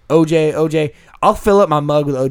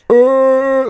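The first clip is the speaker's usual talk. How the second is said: high, long drawn-out "uh"; slow-motion groan; 9 semitones higher, 3 dB louder than usual. Retold or imitated. Storyteller imitating the homeless man's slow swing, slow-motion groan. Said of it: "he was slow motion".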